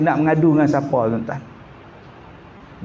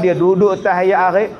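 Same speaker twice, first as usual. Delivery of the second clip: ordinary talk, nothing marked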